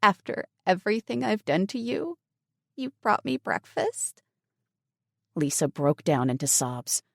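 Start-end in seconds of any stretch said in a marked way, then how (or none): 0.0-2.2 higher, tearful, holding back sobs; words catching in the throat; choked-up voice. A narrator's reading. Lisa overwhelmed by Emily's kindness, choked up. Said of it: "In a choked-up voice". 2.8-4.2 high, tearful you brought me breakfast; choked-up. A narrator's reading. Lisa repeating her words, now choked up. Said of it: "In a choked-up voice".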